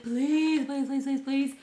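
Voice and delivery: high, loud whiny begging; teacher voicing the pigeon's begging; pleading. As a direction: beg over and over like a desperate pigeon. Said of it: "He pleads".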